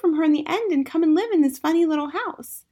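put-on high, eager little-girl voice; curious young Matilda. A narrator's reading. Matilda questioning her teacher, high childlike voice. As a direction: play an inquisitive child, high-pitched.

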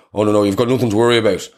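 quick, loud, dismissive fatherly brush-off; dad's breezy reassurance. Retold or imitated. Dad brushing off his son's worry, fast and loud.

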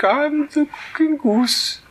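very high, slow silly goose. Acted. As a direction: coo it like a giddy goose. Goose names itself.

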